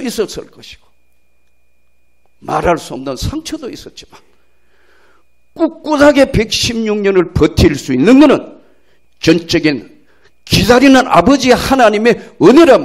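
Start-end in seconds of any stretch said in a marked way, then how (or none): 5.5-12.8 loud, swelling preaching; preaching at full force. Real, not acted. Preacher proclaims God's grace to the church, loud and swelling. Climactic sermon point.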